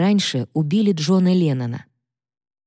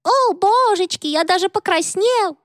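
Mickey Mouse. Retold or imitated. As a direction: squeak it out like a bashful cartoon mouse. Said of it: "пародируя Микки Мауса".

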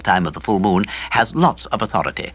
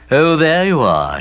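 low husky tomb voice; 6 semitones higher, 4 dB louder, slower than usual. A narrator's reading. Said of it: "low, husky sort of way, like a voice from the tomb".

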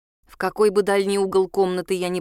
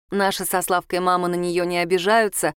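plain reading voice

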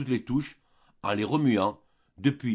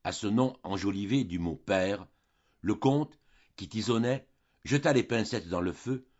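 ordinary delivery, nothing marked

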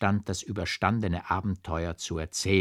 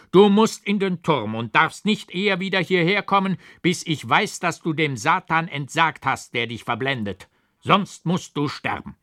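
higher, louder verdict; stern angry command; 7 semitones higher, 6 dB louder than usual. A narrator's reading. Old forester sentences the kneeling monk, stern and angry. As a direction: lay down the sentence without mercy. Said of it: "aber der Alte sagte".

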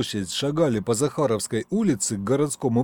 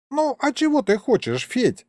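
put-on high, plaintive old man's voice; defensive old grandpa; 12 semitones higher than usual. Grandpa answers his stern grandson, high and defensive. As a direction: shrug it off in a high voice.

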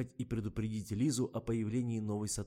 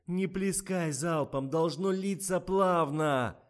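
high, loud, slow shouting; drawn-out director's bellow; yelled instruction; 8 semitones higher, 8 dB louder, slower than usual. A narrator's reading. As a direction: holler each word out slowly. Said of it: "заорал Коля".

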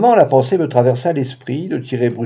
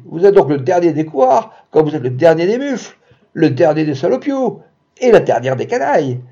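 higher, louder stream of insults; yelling insults; 4 semitones higher, 3 dB louder than usual. Acted. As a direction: hurl each insult harder. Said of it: "hurla-t-il". (insultes hurlées, plus aiguës)

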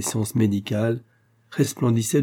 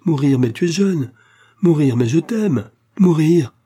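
higher, louder, impassioned protest; anguished outcry; 6 semitones higher, 6 dB louder than usual. A narrator's reading. Pauline refusing her lover's death, in an anguished outcry. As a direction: cry out against it, building to the last word. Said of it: "d'une voix profonde et gutturale".

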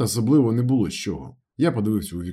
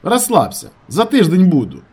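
high, loud easy assurance; light and convincing; 9 semitones higher, 8 dB louder than usual. A narrator's reading. Narrator promising to return soon, light and convincing. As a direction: tell him loudly not to worry. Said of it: "я говорив легко і переконливо".

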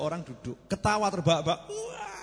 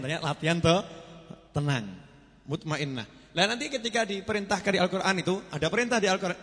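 usual speaking voice

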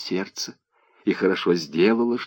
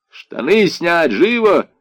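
high, loud, drawn-out command; shouted order; 7 semitones higher, 6 dB louder, slower than usual. A narrator's reading. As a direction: shout it at a prisoner. Guard orders old man to undress. (громкий крик-приказ)

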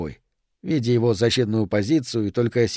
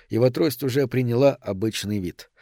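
ordinary delivery

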